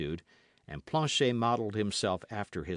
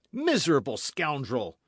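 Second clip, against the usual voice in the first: loud, higher insult; furious cry; 7 semitones higher, 7 dB louder than usual. A narrator's reading. D'Artagnan cursing the host, furious cry. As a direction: spit the insult. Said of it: "cried D'Artagnan".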